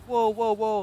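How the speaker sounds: high, alarmed mock whoas; freaking out. Imitating friends as he's grabbed, high freaked-out voice. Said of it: "like, freaking out".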